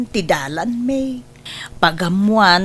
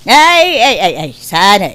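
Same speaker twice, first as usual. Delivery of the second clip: loud flustered ay ay ay; flustered exclaim; 2 semitones higher, 6 dB louder than usual. Acted. Mother flustered when invited to live in the big house, flustered loud protest. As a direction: brush off the offer, caught off guard.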